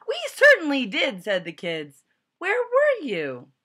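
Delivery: put-on high, loud, eager kid voices; excited kids. A narrator's reading. Relieved kids welcoming their teacher back, high and loud.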